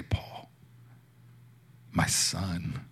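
very soft, almost breathed; hushed voice of God. Retold or imitated. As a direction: say the name gently, barely audible. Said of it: "I just heard him say".

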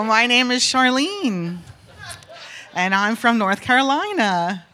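slow, deliberate comic introduction; careful introduction. Retold or imitated. Woman answering the wide-eyed guy, slow careful introduction. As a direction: stretch out name and state, word by word.

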